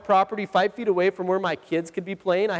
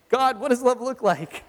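mock-exasperated, higher-pitched prayer; strained anger; 4 semitones higher than usual. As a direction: pray it while holding back irritation. Dad provoked by dented fridge.

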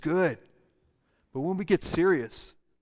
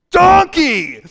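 swear word; 11 semitones higher, 14 dB louder than usual. Shown as a demonstration. Pastor demos donkey as a swear, very loud. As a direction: spit "Donkey!" like a curse, much higher and louder. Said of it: "say it like a swear word".

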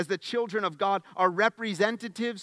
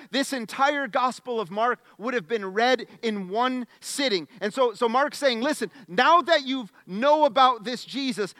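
louder, higher building sermon; preaching; 6 semitones higher, 6 dB louder than usual. Acted. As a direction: stack the miracles with rising fire.

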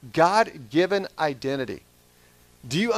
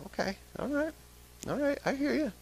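higher, soft, sheepish okay; sheepish giving in; 10 semitones higher, 9 dB quieter than usual. Retold or imitated. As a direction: give in meekly to the Lord. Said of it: "And I was like".

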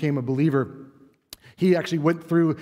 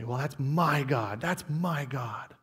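softly claiming God as mine; slower and softer, owning it; proud, quiet mine; 3 semitones lower, 7 dB quieter, slower than usual. Retold or imitated. You say it proudly, softly and slowly. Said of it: "You say".

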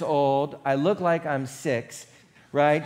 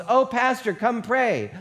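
higher-pitched, eager invitation; eager calling out; 8 semitones higher, 3 dB louder, slower than usual. Retold or imitated. Patient calls her pastor in before the staff, eager.

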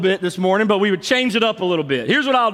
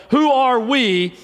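higher, drawn-out mock cheer call; imitated yell; 5 semitones higher, slower than usual. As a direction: call it out like the lone fan, restrained. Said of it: "He would yell".

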